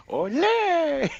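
high-pitched drawn-out ole; playful high matador call; mock matador cry. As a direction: whoop like a bullfighter, laughing after. Plays matador dodging.

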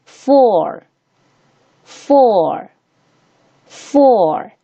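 four with the or drawn out and falling; long OR vowel. Shown as a demonstration. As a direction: say four slowly, holding the vowel. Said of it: "它就发长元音or".

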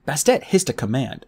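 ordinary delivery, nothing marked

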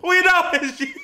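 laughing shout. Real, not acted. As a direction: yell it through laughter. Busting the fake voice.

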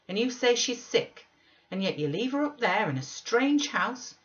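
high, loud, reproachful pleading; impassioned scolding. Acted. Nelly scolding selfish Linton over Catherine, high and impassioned. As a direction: rebuke him with rising passion.

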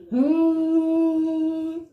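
a high sung hum; humming fridge. Acted. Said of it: "went the fridge".

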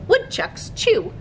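usual speaking voice

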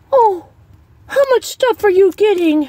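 high, prying sing-song; nosy cashier mimic. Mocking the condiment police lady, high and prying. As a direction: mimic a busybody checking your cart.

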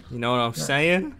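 ordinary delivery

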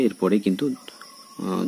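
normal talking voice

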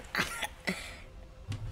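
quiet airy chuckle; soft breathy laugh. Real, not acted. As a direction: let out a silent laugh. Laughs after near death.

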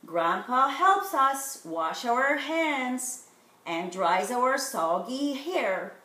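ordinary delivery

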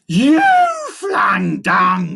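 loud, high, stretched accusation; knowing bellow. A narrator's reading. Monkey catching his little brother, knowing bellow. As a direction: bellow it, landing hard on the first word. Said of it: "Furley bellowed knowingly".

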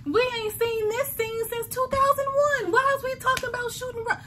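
high, fast mock-exasperated complaint; exasperated reaction. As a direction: toss it off like you can't believe it's back. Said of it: "I was like".

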